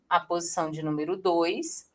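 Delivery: usual speaking voice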